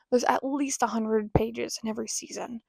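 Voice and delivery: ordinary delivery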